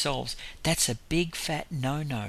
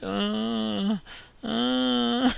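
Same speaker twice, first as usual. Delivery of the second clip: high, drawn-out mock moans; long droning "uhhh", higher than talk; imitated moaning; 9 semitones higher than usual. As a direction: keep moaning on a held high note, imitating him. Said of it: "he just keeps moaning".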